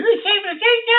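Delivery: high squeaky voice for the caller; Leonard's voice. Retold or imitated. Retelling the strange caller, high mimicking voice.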